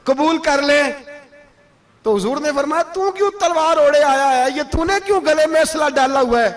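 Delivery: high, ringing retold dialogue; impassioned preaching. Preacher retelling an offer of sons for battle, impassioned.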